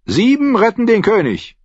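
high, loud jolly toast; cheerful call. A narrator's reading. Archer toasting his company, cheerful shout. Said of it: "rief Anguille, der Schütze, fröhlich".

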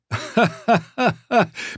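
high, slow knightly laugh; hearty laugh. Acted. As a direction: laugh it off boldly. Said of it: "Sir Patrick lachte nur".

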